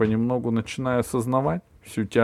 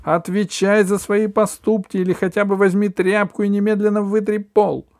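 much higher, loud shout; 12 semitones higher, 7 dB louder than usual. A narrator's reading. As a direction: scream it in fury. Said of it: "кричала мама".

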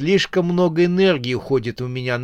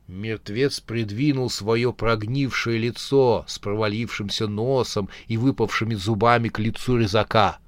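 ordinary delivery

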